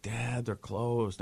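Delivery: soft, drawn-out letdown; mock dejected child; disappointed kid. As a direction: let it drag out quietly, like a let-down kid. Son's disappointment acted in story.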